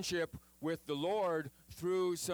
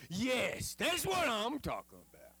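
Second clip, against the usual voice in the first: higher, punchy eager yes; excited agreement; 7 semitones higher than usual. Retold or imitated. Moshe answering God, mock eager and excited. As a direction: jump on it like a fan cheering.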